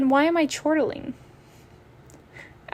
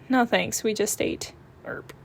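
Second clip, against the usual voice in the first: ordinary delivery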